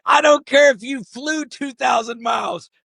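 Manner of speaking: high-pitched shouted scolding; mock yell. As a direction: holler it out in mock outrage. Mock referee yelling a foul call.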